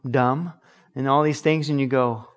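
usual speaking voice

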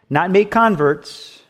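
ordinary talk

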